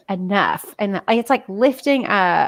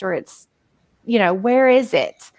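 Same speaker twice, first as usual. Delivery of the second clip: ordinary delivery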